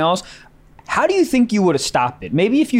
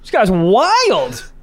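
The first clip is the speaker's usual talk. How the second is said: mock stand-up comic; 7 semitones higher, slower than usual. Joins the mock stand-up act.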